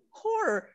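"horror" run into one slow syllable, "hor"; struggling pronunciation. Shown as a demonstration. Admitting they can never pronounce it, "horror" as one "hor". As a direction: draw "horror" out as a single "hor". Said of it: "I can never pronounce horror".

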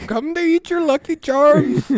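high, loud cartoon Irish voice; hammed-up Irish holler; bad Irish accent. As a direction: shout it like a mock Irish leprechaun. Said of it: "Gotta sound very Irish".

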